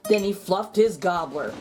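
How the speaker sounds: ordinary delivery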